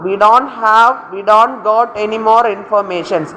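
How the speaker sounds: slow, careful dictation of a sentence with an error; very slow exam-question reading; reading out the faulty sentence. Shown as a demonstration. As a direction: read the wrong sentence out slowly for the class. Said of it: "Informations എന്നുള്ള usage ഇല്ല".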